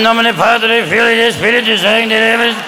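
aged pope's droning blessing; John Paul the Second. Acted. Old pope blessing the crowd, droning impression. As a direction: intone Latin in a mock slurred monotone.

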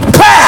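sudden high spat-out hit noise; smack sound effect. Acted. Teen knocking the brother off, explosive smack noise. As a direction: punch out a sharp impact sound. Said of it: "So I went".

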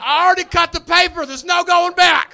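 frustrated shout. Real, not acted. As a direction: blow up over the lost tape. Raging about the ex taking tape.

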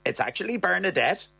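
a hint of the girlfriend correcting him; Bernadette's voice.

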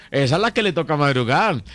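ordinary talk, nothing marked

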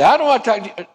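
a put-out acquaintance snubbing you; mock huffy refusal. Offended person refuses apology.

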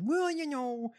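mocking politician impression. Podcaster retelling her debate answer, mock politician voice. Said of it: "and she said".

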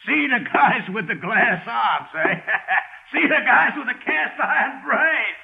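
barker lines broken by laughs; laughing barker. Acted. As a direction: bark it out, cracking up. Mimics barker while laughing.

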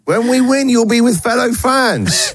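high-pitched rallying call; rallying fans. Retold or imitated. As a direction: urge a mate down to the pub, pitched well up. Fan club's invite acted out.

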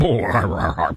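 low gurgling noise from the throat; throaty gurgle. Pretend. Host interrupts the quote he reads out, throaty gurgle. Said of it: "something in my throat there".

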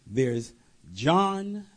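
ordinary talk, nothing marked

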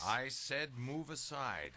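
insistent command. Acted. Father repeats his order to son, insistent. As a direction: repeat it slowly as a threat.

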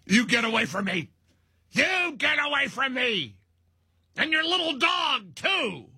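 high, cackling witch impression of Pelosi; hammed-up high witch voice, a cackle in the middle; mock Wicked Witch. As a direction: screech it like the Wicked Witch shooing Dorothy. Host mocking Pelosi, witch line.

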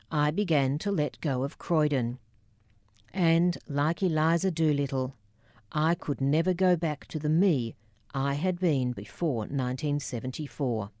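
usual speaking voice

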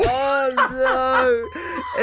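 mortified cry. Real, not acted. Mortified by his clip.

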